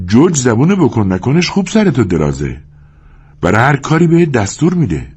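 high, lightly mocking woman's voice; amused young woman. A narrator's reading. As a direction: tease him as the young woman. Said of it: "زن خندید که". (زن جوان با لحن شوخ و صدای زیر)

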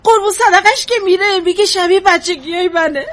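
loud, high, crying accusation; tearful outburst. Acted. Mother blames her own mother, crying out loudly. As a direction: wail the blame through tears.

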